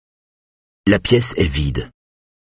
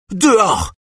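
yelling; 13 semitones higher than usual. A narrator's reading. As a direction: scream him out of the house. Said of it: "hurle Liselotte Blow". (Liselotte hurle à Eric, cri aigu et fort)

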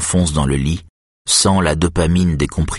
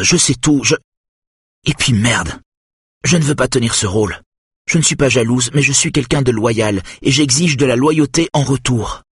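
voiced wife, agitated; 7 semitones higher than usual. A narrator's reading. Wife confronting husband about his old betrayal, agitated. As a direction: let the wife's anger spill out in a raised voice. Narrator voices Simone's outburst.

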